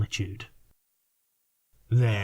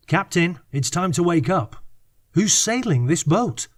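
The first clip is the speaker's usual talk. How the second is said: higher, louder call to wake him; 7 semitones higher than usual. Acted. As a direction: raise the voice to rouse the captain. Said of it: "so I spoke louder".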